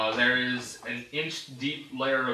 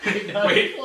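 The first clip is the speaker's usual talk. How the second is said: normal talking voice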